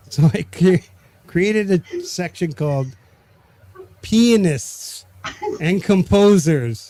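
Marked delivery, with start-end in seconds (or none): 4.0-6.9 slow, careful and a bit higher; said slowly. Shown as a demonstration. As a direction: spell each word out slowly. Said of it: "I'll say this slowly".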